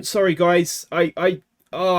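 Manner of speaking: ordinary talk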